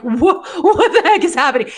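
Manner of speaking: high, loud, laughing disbelief; retold bewilderment. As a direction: act out stunned confusion, half laughing. Acts out the stunned group's reaction.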